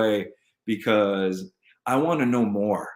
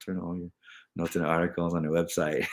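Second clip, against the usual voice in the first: normal talking voice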